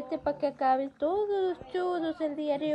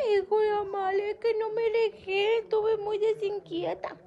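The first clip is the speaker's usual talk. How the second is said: mock crying; 6 semitones higher than usual.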